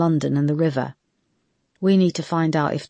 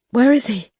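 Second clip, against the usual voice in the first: fearful; 8 semitones higher, 6 dB louder than usual. A narrator's reading. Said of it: "another wave of fear and nausea".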